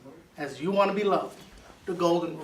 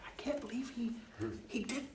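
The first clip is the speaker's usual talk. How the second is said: awed whisper; 4 semitones higher, 15 dB quieter than usual. Retold or imitated. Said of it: "They'll be like".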